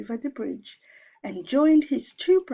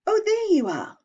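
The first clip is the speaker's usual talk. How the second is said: much higher, louder bright goat voice; middle goat voice; 13 semitones higher, 7 dB louder than usual. Acted. Middle goat spotting his little brother, high and loud. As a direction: call out happily to the brother.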